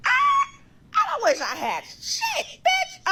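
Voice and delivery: shrieking, high-pitched mock comeback; shouted retort. Firing back at the yelling rival, shrieked retort. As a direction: shriek it back, drawing the words out.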